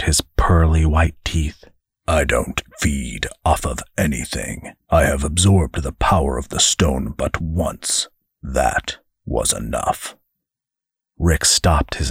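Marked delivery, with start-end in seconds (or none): 2.1-10.2 low, quiet angel voice; the angel denies feeding on anyone; cold angel. Acted. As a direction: lower and softer, clipped denial. Angel replies coldly.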